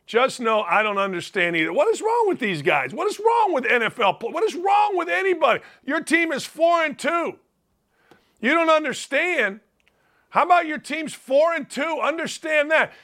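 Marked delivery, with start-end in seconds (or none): 1.7-9.6 raised, incredulous rant at the players; exasperated shout. Real, not acted. Ranting at NFL players, exasperated shouting. 10.3-12.9 exasperated rant. Real, not acted. Ranting at NFL players.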